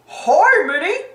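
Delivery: loud, swooping cartoon Pete; big put-on character call. A narrator's reading. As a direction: holler it as a cartoon heavy. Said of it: "he calls".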